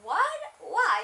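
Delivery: high, loud startled "what? why?"; mom's shocked reply, high and loud; surprised. Retold or imitated. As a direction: jump up in pitch, alarmed. Mom's shocked reaction retold.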